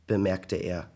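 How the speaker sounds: usual speaking voice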